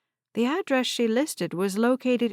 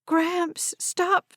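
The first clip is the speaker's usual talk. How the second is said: pleading cry; 9 semitones higher than usual. Acted. Harper pleading with grandfather.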